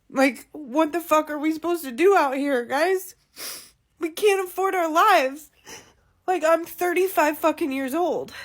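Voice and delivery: loud fed-up rant at the cost of living; exasperated rant. Real, not acted. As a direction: let the frustration burst out loud. Venting about unaffordable life.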